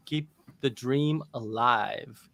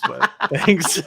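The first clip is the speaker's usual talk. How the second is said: laughing; 13 semitones higher, 10 dB louder than usual. Real, not acted. Laughing at being called Chinese.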